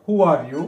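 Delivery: who are you, dropping the pitch on you; falling tone. Shown as a demonstration. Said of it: "ପକେଇକିରି କହିବା".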